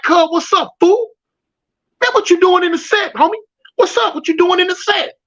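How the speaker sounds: high, pressing street challenge; confronting challenge. Retold or imitated. Stepping to the rival in their set, high and challenging. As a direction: get up in his face with it.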